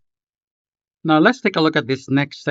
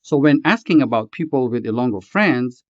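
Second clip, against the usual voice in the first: ordinary delivery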